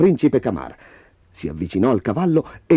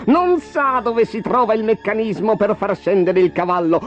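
high-pitched, malicious villain voice; gloating dwarf; 7 semitones higher than usual. A narrator's reading. Dwarf dooms the flying prince, high and gloating. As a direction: gloat high, relishing the doom. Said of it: "ghignò il nano".

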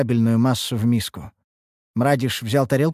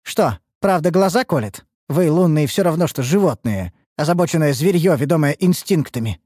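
high, needling attack; heated, contemptuous jeer; scornful taunt; 7 semitones higher, 4 dB louder than usual. Acted. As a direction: throw insults at a rival. Elf hurls insults at rival.

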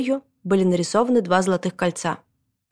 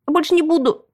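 high, small squeak; squeaked apology; 9 semitones higher, 4 dB louder than usual. A narrator's reading. Boy promising his scolding mother, squeaking. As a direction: squeak it out meekly. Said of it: "пискнул я".

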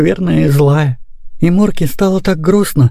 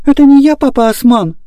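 put-on breathless boy; 9 semitones higher than usual. A narrator's reading. Boy denying blame to papa, breathless high protest. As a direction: blurt it out as an indignant small boy. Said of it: "задыхаясь, начал Лёня".

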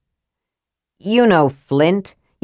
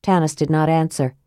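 usual speaking voice

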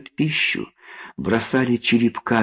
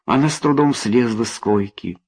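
ordinary delivery, nothing marked

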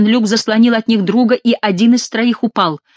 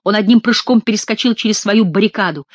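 ordinary delivery

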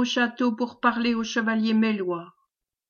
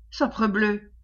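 usual speaking voice

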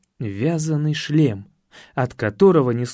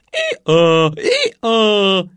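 орущий осёл; 5 semitones higher, 5 dB louder than usual. Acted. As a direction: bray like a stubborn donkey. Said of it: "Осёл заорал". (громкий ослиный рёв)

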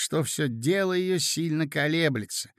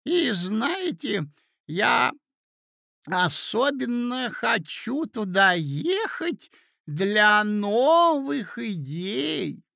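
put-on high, slow, drawn-out old man's voice; doddering old prince; 6 semitones higher, much slower than usual. A narrator's reading. Old prince telling the ladies his travel plans, doddering. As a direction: stretch each word out in a high pitch.

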